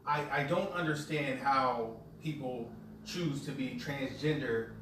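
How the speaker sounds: usual speaking voice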